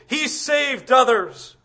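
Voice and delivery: a hint of a slow sneer; mock him lightly. Preacher imitating the mockers at the cross, lightly sneering. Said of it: "the mockings and the sneerings".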